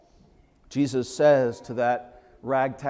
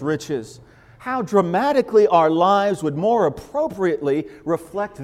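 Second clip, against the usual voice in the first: building preacher's voice, louder toward the end; preaching quote; 5 semitones higher, 6 dB louder than usual. Acted. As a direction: read the quote as a rising sermon. Reads quoted author with rising force.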